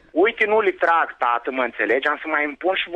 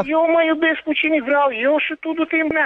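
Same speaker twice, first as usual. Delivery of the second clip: angry shout; 10 semitones higher than usual. Real, not acted. Accused neighbour yelling back at the caller angrily.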